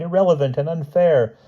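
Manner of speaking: high, indignant objection; hammed-up high-pitched outrage; raving Hyde. A narrator's reading. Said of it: "raved Hyde".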